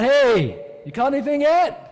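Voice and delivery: loud, high call across the water; yelling out. Acted. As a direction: holler it to a boat far offshore. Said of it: "yells out".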